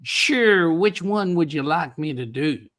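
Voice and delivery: put-on American cowboy accent; cowboy Southern accent. Shown as a demonstration. Australian guest asked for an American accent, Southern cowboy drawl. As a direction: play a quintessential Southern cowboy. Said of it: "talk about quintessential cowboy. That's Southern".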